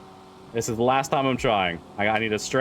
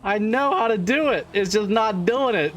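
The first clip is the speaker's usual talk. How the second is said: frustrated; 7 semitones higher than usual. Real, not acted. Stunt keeps failing.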